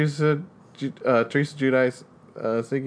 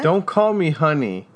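a touch of mock indignation, louder; mock quote; 5 semitones higher, 6 dB louder than usual. Teasing about her partner, mock indignant quote. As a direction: bark it like a playful partner. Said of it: "Sean likes to say to me".

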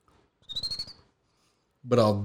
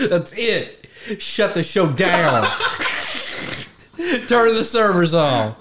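loud, high, drawn-out laughing call; mock shutdown order; 7 semitones higher, 4 dB louder, slower than usual. Co-host jokingly ends the show over the remark, mock shutdown order.

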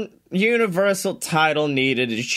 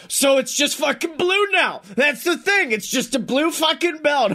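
higher, exasperated mock rant; 7 semitones higher than usual. Retelling Dylan's rant about the blue belt, mock exasperation. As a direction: imitate a friend ranting in disbelief. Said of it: "went on a fucking rant".

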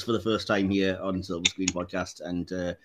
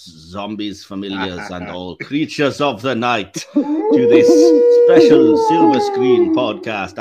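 a cheesy Dracula welcome, drawn out; mock vampire accent; 8 dB louder, slower than usual. Host greeting creatures of the night in a hammy vampire accent. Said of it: "I'm gonna stop doing that mildly offensive accent now".

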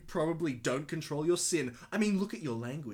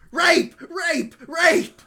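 high, loud repeated yells; yelled comment; 13 semitones higher, 13 dB louder, much slower than usual. Acted. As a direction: shout the comment out in high bursts. Reading out a one-word comment, yelling.

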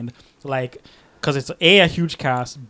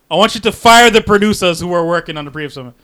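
desperate demand; 4 semitones higher, 12 dB louder than usual. Retold or imitated. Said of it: "He's like".

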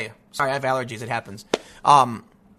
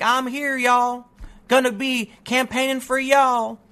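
light mock Southern drawl, higher and slow; 6 semitones higher, much slower than usual. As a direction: imitate a politician faking a Southern accent. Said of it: "started talking with a Southern drawl, like a little light one".

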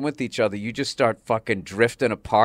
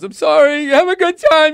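high, loud mock blubber; laughing through crying; 12 dB louder than usual. Wife apologizes to husband before his show, laughing through crying. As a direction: imitate a wife sobbing and laughing at once. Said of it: "laughing through crying".

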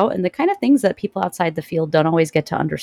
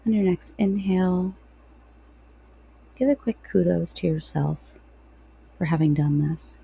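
guided meditation. Real, not acted.

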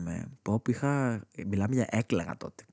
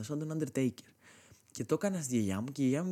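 ordinary delivery

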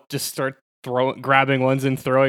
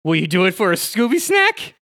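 wheedling, higher cartoon voice; Shaggy; 4 semitones higher, 4 dB louder than usual. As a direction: coax a reluctant dog with a treat. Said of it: "tell Scooby to go in first".

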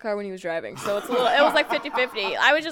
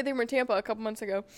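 usual speaking voice

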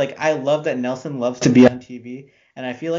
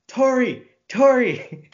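high, louder mock holler of a name; mock yell; 10 semitones higher, 4 dB louder, much slower than usual. Co-host imitating someone calling over a head, mock yell. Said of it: "look over her head and yell".

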